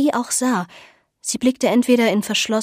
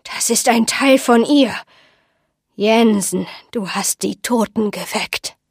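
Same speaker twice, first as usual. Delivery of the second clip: ominous accusation. Acted. Old woman accuses Jensen publicly.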